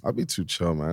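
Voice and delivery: ordinary delivery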